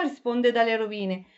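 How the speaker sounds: plain reading voice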